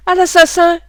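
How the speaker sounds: loud, high cry of murder; shout. A narrator's reading. Noé screams for help after being knocked down. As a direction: yell for help in panic. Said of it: "criait Noé".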